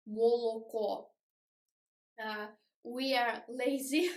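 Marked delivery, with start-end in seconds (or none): 0.1-1.1 "молоко" with three full round O's, mo-lo-KO; unreduced O. Shown as a demonstration. Teacher showing the especially wrong "молоко", every O fully rounded. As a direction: pronounce every O in "молоко" as written. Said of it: "especially we don't say".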